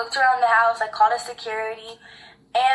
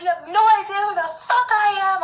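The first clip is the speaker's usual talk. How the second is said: mock rage; 8 semitones higher, 5 dB louder than usual. Angry text read out, laugh heard.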